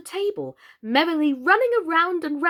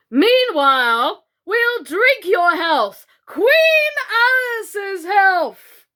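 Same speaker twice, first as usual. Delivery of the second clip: high, loud, slow screamed toast; screaming toast; 8 semitones higher, 8 dB louder, much slower than usual. A narrator's reading. Red Queen screaming a toast to all guests, loud. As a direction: scream it to the whole table. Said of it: "she screamed at the top of her voice".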